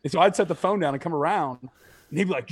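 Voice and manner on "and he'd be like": ordinary delivery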